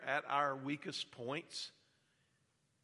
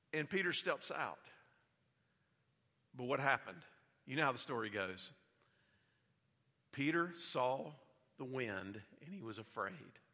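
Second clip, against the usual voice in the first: normal talking voice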